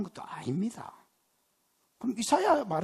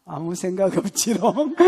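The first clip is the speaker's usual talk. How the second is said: 웃으며 놀리는 말투; 4 dB louder than usual. Pretend.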